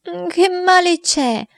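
put-on high, slow whimpering boy; whiny voice. Boy whines to his father about the dog. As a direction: whine it like a sulking child. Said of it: "con voce piagnucolosa".

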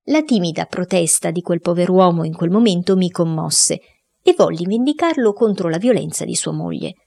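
plain reading voice

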